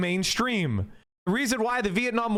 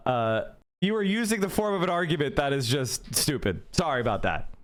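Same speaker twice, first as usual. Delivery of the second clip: ordinary talk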